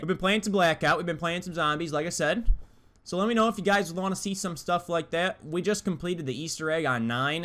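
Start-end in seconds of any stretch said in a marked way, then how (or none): none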